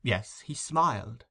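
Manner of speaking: ordinary delivery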